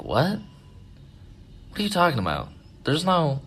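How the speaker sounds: high-pitched retold disbelief; incredulous. Babysitters doubt the boy's friend exists, retold incredulous reaction. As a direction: question it as if it can't be true.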